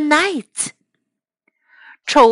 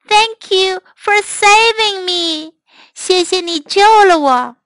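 grateful princess; 6 semitones higher than usual. Acted. Princess thanks dragon.

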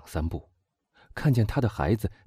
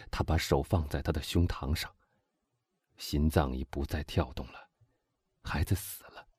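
softer, lower narration sinking away; hushed grief; 3 semitones lower, 5 dB quieter than usual. A narrator's reading. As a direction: let the voice fade towards the death. Narrating discovery of child's death. (压低声音、沉痛地叙述)

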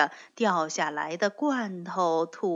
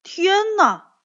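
high, loud dismayed exclaim; shocked cry; 10 semitones higher, 8 dB louder than usual. Acted. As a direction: cry out in horror. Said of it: "跳跳大声叫道". (大声惊叫)

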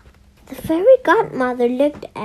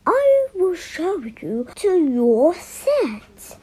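slow, higher teacher voice; 6 semitones higher, slower than usual. A narrator's reading. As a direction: guide the children calmly to their seats. Voices Mister Wise.

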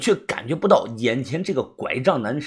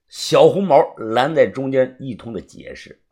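ordinary delivery

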